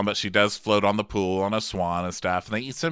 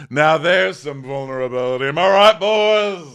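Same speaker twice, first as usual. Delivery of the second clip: loud, high cabaret-style punchline; Luann impression; 5 semitones higher, 7 dB louder than usual. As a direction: belt it out to the crowd. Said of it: "And Luann's like".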